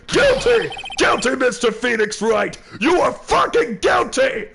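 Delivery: a mock judge screaming a guilty verdict; shouted verdict. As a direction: bellow the sentence.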